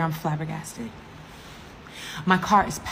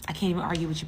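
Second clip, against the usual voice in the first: normal talking voice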